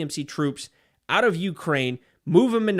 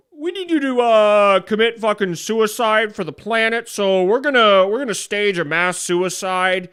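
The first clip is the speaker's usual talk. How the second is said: high, loud put-on villain leader voice; mocking high-pitched leader announcing the plan; head lefty voice; 5 semitones higher, 6 dB louder than usual. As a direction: play the smug lefty boss ordering a mass suicide. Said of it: "this is me, head lefty talking".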